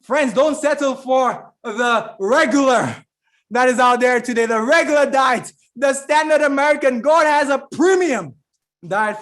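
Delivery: loud, insistent pulpit exhortation; preaching. Real, not acted.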